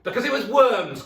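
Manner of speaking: shrieked very high and loud in glee; full gleeful shriek; triumphant cry. Acted. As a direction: crow in triumph. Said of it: "cried Missus Twit".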